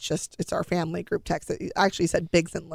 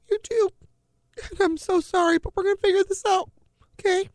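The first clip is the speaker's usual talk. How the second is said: much higher, voice breaking with tears; tearful; 12 semitones higher than usual. Real, not acted. Telling daughter she has leukemia.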